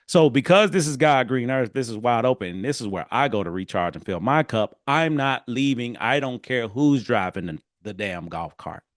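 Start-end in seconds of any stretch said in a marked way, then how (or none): none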